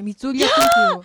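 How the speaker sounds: loud, high, eager demand; excited shout. Real, not acted. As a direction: shout it out, begging to see. Eagerly demanding a tongue trick.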